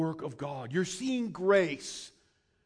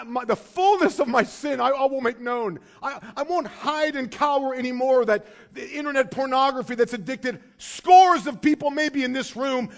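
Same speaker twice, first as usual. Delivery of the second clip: earnest confession; 6 semitones higher, 8 dB louder than usual. Retold or imitated. Voiced confession to God.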